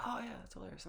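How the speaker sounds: high, gushing imitation of onlookers; put-on delighted listener; mock amused reaction. Acting out people reacting to "wicked.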